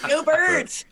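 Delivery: loud, high Philly fan yell; Philly accent. Acted. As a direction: holler it like a Philadelphia sports fan. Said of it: "I don't hear the accent at all".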